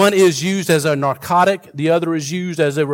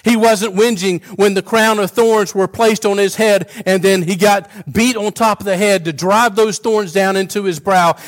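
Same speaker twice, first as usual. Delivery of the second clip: ordinary talk, nothing marked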